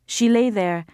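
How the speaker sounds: plain reading voice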